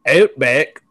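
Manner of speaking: ordinary talk, nothing marked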